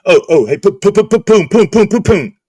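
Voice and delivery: high, loud, stuttering mock of a goofy guy; imitating Gaker. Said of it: "That's how he talks".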